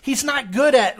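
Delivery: ordinary delivery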